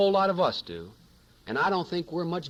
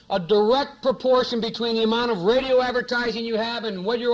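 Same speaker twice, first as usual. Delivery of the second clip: raised, driving voice, pitched higher; impassioned push; 5 semitones higher, 4 dB louder than usual. Real, not acted. Senator to audience about campaign pressure, raised and impassioned. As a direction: build the pressure, pressing hard on every phrase.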